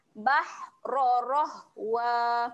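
"Bahra rahwa" with thick, full ra sounds; slow heavy "Bahra rahwa". Shown as a demonstration. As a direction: recite the ra deep and heavy. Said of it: "baca dengan tebal".

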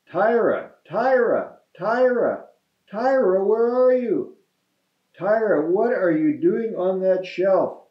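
high, insistent calling. A narrator's reading. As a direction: call out for a lost child. Father searching for lost daughter.

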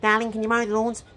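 high-pitched nagging wife impression. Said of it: "she's a real nagger".

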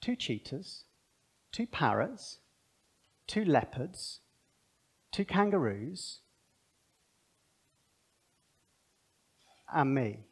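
hushed, slow, dawning wonder as Adam; Adam's quiet realisation. Retold or imitated. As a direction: let it dawn on him softly. And then he goes.

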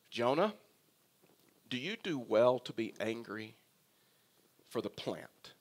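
gentle, low, softened questioning; God. Acted. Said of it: "Here's how loving God is".

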